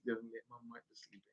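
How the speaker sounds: normal talking voice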